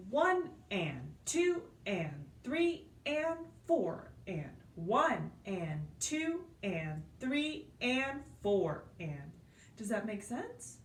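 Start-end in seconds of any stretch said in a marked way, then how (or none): 0.1-7.7 slow, even counting with and between beats. Shown as a demonstration. Teacher demonstrating the rhythm count to students, slow and even. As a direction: count it out steadily, stretching each and. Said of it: "So it sounds more like this".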